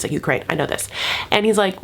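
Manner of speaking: ordinary delivery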